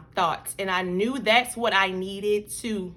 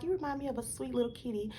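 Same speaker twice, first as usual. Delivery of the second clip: much softer, quicker and gentle; mother softly cooing to her daughter; soft and tender; 2 semitones higher, 11 dB quieter, faster than usual. Retold or imitated. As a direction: coo it to your little girl. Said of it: "Like, I told her".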